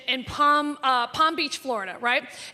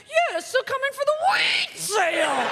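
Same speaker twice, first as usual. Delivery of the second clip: exaggerated Martha Stewart; 9 semitones higher than usual. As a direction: belt out "wheat" like a proud pitchwoman. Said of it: "she's like".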